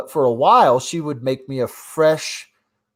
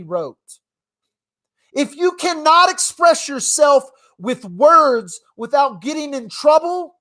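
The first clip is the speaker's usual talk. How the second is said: raised, louder, higher pitched outrage; worked-up rant; 11 semitones higher, 4 dB louder than usual. Real, not acted. Telling the audience about a jailed teen, in a loud indignant rant.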